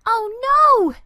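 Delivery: high, loud dismayed oh no; a boy's loud shocked cry at the mess. Acted. Andy crying out at eggs broken on the floor, loud and high. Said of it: "Andy cried".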